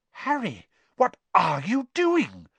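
put-on high-pitched, questioning girl's voice; Hermione. A narrator's reading. Hermione calling Harry out of his daze, high-pitched questioning. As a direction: pitch it well up, as a challenge. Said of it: "said Hermione's voice from a long way off".

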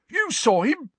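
high loud rounding on her; incredulous snarl. A narrator's reading. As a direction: turn on her in disbelief. Filch rounds on Cho.

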